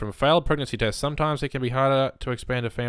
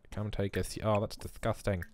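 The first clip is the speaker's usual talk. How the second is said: ordinary talk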